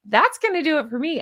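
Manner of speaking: usual speaking voice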